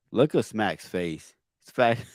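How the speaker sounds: normal talking voice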